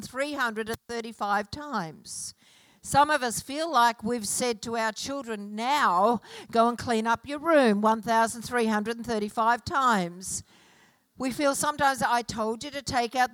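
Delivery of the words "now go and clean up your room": ordinary delivery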